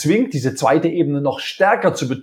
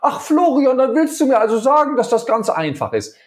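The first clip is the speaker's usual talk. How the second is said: provoking sarcasm; 9 semitones higher than usual. Retold or imitated. Colleague provoking Florian in a meeting, sarcastic mocking voice. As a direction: needle him with sarcastic disbelief. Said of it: "Da will mich jemand provozieren".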